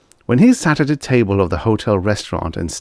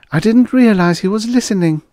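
lightly voiced young woman, higher; a helpful young waitress, raised voice; 5 dB louder than usual. Girl character voice.

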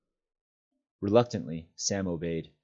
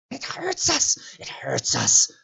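breathy hissed whisper of a pained creature; hissing Gollum; 5 dB louder, slower than usual. A narrator's reading. Gollum hissing at Sam over the tight rope. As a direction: hiss it out in pain. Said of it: "hissed Gollum".

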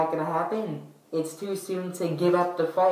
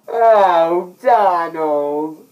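mock swooning cry; 2 semitones higher, 9 dB louder, much slower than usual. Calling out to Donald.

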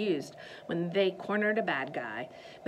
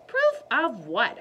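feigned innocence; 4 semitones higher, 8 dB louder, slower than usual. Acted. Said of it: "pretending she didn't know".

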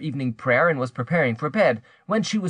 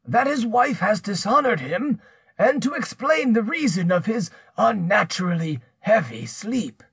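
higher-pitched, menacing accuser's voice; threatening accuser; 9 semitones higher than usual. Acted. Pierre threatens to publish her secret, menacing. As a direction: press the threat, drawing out the ruin.

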